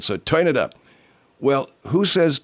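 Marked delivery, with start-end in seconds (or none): 0.2-0.7 higher Southern "toin it up"; Southern quirk, oy for er; Southern accent. Acted. Host demonstrating the Jacksonville quirk, Southern accent. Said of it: "the very distinctive Southern accent".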